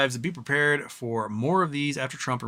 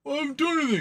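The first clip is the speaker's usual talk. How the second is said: much higher, protesting voice; whiny punk; 13 semitones higher, much faster than usual. Retold or imitated. Punk protesting to the bartender, in a high whiny voice. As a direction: play the punk claiming innocence. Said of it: "He's like".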